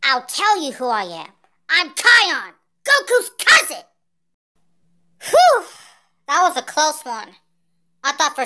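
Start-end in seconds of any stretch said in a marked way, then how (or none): 1.7-3.8 loud, high heroic self-introduction; bold announcement. Acted. Hero telling an enemy who he is, bold announcement. 5.2-5.6 high, loud sigh of relief; relieved whew. Acted. Fighter after a close call, relieved whew.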